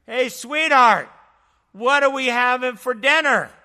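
yelling upstairs. Retold or imitated. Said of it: "So he yells upstairs".

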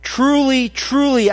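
"Truly, truly" high and loud, pressed hard; both "truly" words stressed, rising high above the rest; emphatic. Shown as a demonstration. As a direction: proclaim "Truly, truly" with strong weight. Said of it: "Jesus is being emphatic here".

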